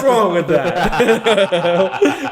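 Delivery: laughing mock offense. Laughing at a friend's joke, mock offended. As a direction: pretend to be hurt while giggling.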